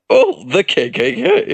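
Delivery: teasing high, loud imitation of excitement; mimicked enthusiasm. Friend teases the reader by copying him, mock enthused. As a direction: parrot someone's overexcited reading. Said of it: "Bro sounded enthused when he read that".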